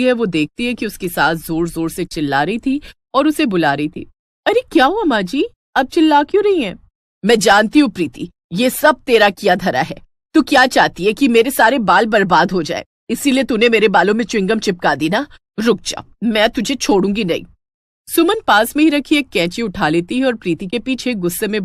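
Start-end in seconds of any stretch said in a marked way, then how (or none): 4.5-6.8 startled, raised voice; what's all the noise, alarmed and higher; alarmed higher call. Acted. Woken by shouting. 7.2-17.4 furious accusation. Acted. Furious mother-in-law accuses.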